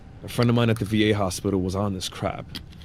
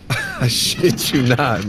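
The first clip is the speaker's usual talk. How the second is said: laughing; 2 semitones higher, 4 dB louder, much slower than usual. Real, not acted. Laughs at his friend's sleepwalking.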